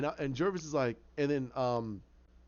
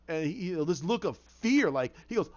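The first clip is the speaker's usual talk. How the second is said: ordinary delivery, nothing marked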